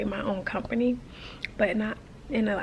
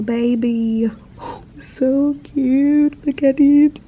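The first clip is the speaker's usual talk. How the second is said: higher, much louder squeals of cute; cooing delight; 4 semitones higher, 10 dB louder, slower than usual. Real, not acted. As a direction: gush like over a puppy. Cooing over baby animal.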